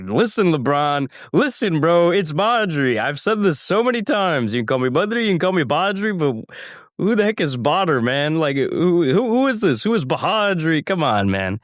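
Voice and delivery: high, exasperated protest at LeBron; exasperated correcting. Pretend. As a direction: whine it out, fed up with the wrong name. Mock scolding LeBron over name.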